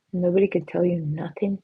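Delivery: usual speaking voice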